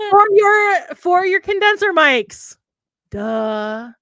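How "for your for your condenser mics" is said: very high, loud and drawn out; high-pitched slow joke shout; mock PSA punchline. Punchline to mock safety reminder.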